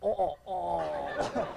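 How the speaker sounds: slow, drawn-out frightened moans with a laugh; panicked groans. Retold or imitated. Co-pilot clutching seat, slow frightened moans. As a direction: moan in fear, half laughing. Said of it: "And John's going".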